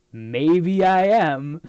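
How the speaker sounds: drawn-out drunken admission, hammed up; drunk uncle. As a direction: imitate a tipsy man, stretching the words. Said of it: "half passed out drunk on the couch".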